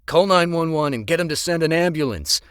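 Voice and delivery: loud, high urgent order; urgent command. Acted. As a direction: bark it out in a crisis.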